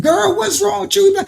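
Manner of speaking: high, exasperated scolding; incredulous high-pitched retort. Retold or imitated. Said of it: "I said".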